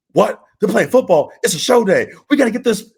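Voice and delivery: high, loud mock outrage; riled up. Pranked wrestler hears trainees play football on show day, riled up. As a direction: act out yourself getting wound up by a prank. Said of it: "get me riled up".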